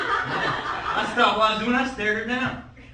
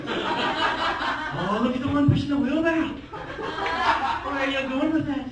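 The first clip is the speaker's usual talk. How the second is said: higher-pitched, not-quite-Scottish grandpa; mock-Scottish accent; 5 semitones higher, 4 dB louder than usual. Grandpa suspecting gnomes of theft, mock-Scottish accent. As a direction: build to indignation in a fake Scottish accent. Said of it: "I like doing the accent".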